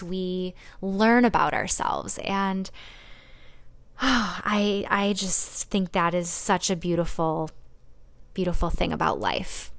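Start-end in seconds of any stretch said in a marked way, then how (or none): none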